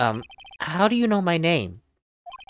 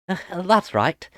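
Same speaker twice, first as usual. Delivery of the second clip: normal talking voice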